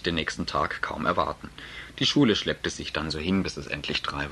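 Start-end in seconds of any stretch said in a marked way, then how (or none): none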